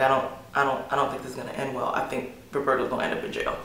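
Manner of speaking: low, soft worried aside; hushed aside. Retold or imitated. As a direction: murmur it confidentially to a friend.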